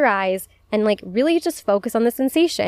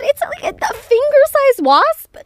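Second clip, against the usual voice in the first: outraged exclaim; 5 dB louder than usual. Retold or imitated. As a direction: cry out that it shouldn't exist. Outraged about the huge wasp.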